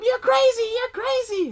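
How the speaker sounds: very high mock screech; hammed-up angry wife; shrill wife yelling. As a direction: screech at the husband. Wife slapping him, retold.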